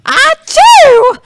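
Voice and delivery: big comic sneeze; loud sneeze. Acted. Sneeze on evidence.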